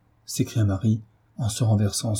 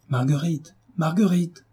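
much higher alarmed call; 7 semitones higher than usual. Acted. Doctor calling the maid, alarmed.

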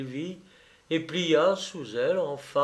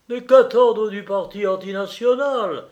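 loud, higher-pitched outburst; colonel's exclamation; 6 semitones higher, 8 dB louder than usual. A narrator's reading. The colonel cuts in on the lawyer, exclaiming. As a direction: cry it out in indignation. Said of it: "s'écria le colonel".

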